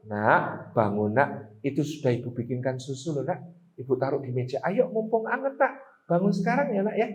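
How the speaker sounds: low, soft, gentle waking voice; a quiet, loving mother's murmur; soft kind mother. Shown as a demonstration. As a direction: tenderly wake a sleeping child. Second mother waking child kindly.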